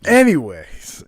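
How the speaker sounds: a loud, emphatic anyways to push past the slip; loud reset. Acted. Co-host loudly pushes past his own embarrassing slip, emphatic. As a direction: announce the change of subject with a loud anyways.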